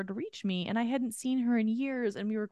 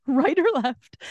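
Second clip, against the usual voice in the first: loud, raised mock yell; exasperated, higher and louder; retold yelling; 5 semitones higher, 8 dB louder, slower than usual. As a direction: demand it loudly, as if at a stranger. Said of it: "I'm like, yelling at this lady".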